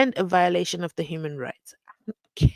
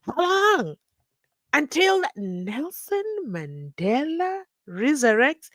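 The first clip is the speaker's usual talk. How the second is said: incredulous mockery; 7 semitones higher, slower than usual. Real, not acted. Mocking the adjournment request.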